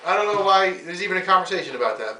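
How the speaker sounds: ordinary delivery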